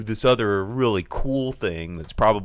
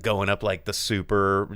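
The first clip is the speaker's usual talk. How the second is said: usual speaking voice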